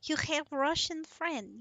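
cartoonish Russian; Russian accent. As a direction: play up a mock Russian accent. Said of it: "this is how they sound".

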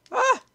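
high sudden yelp of fright; short frightened cry; startled yelp. Real, not acted. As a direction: jump at the scare.

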